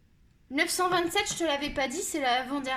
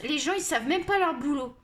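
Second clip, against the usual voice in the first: usual speaking voice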